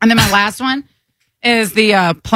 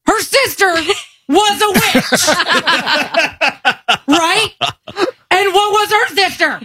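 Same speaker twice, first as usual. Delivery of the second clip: indignant meme rant; 10 semitones higher than usual. Acted. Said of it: "Grow up".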